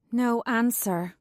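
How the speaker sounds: ordinary delivery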